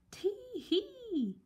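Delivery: very high gleeful tee hee; chortle. A narrator's reading. Mister Happy chortling to himself with glee. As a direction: giggle with glee. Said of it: "he chortled".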